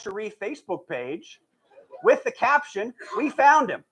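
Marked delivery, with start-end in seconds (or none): 3.2-3.8 proud, higher we found him; triumphant announcement. Retold or imitated. Facebook caption read triumphantly.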